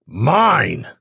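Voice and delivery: loud, high furious shout; angry shout. A narrator's reading. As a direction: roar the word. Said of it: "he growled".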